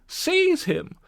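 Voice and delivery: high, loud furious cry. Acted. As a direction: yell to grab him. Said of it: "they cried".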